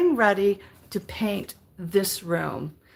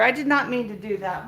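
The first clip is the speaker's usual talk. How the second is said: ordinary talk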